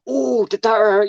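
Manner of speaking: high-pitched imitation of a bystander; lightly mock a silly question; mock concern. Mocking what people ask after falls.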